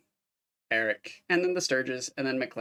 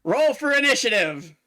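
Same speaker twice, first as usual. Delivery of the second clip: excited announcement; 8 semitones higher, 5 dB louder than usual. Acted. GM starts combat.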